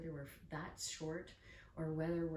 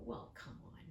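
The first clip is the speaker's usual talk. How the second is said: dismissive; 6 dB quieter, much slower than usual. Retold or imitated. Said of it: "they kinda go".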